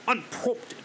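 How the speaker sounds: lightly put-on British "Unprompted"; mocking his sudden British "Unprompted"; cartoon British accent. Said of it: "sounds like a fat, overweight cartoon British person".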